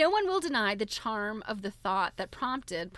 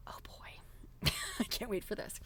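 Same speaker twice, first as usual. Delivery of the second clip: soft, breathless whisper full of glee; a giddy whispered aside; gleeful whisper; 2 semitones higher, 6 dB quieter than usual. Real, not acted. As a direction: lean in and whisper your delight. Gleeful aside before a quote.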